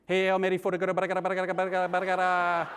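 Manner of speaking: gibberish rosary in a mock Tagalog accent. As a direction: rattle off the prayer in a heavy Tagalog accent. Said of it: "with a really strong Tagalog accent".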